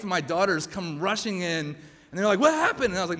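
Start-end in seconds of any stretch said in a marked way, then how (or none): 2.4-2.9 high, loud worried question; alarmed family. Acted. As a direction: rush in with acted alarm.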